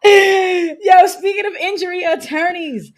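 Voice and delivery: loud, high and laughing hard. Real, not acted. Telling friends about the subway doors, laughing loudly. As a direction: squeal it out through real laughter.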